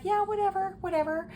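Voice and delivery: dismissive. Retold or imitated. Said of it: "I was just like".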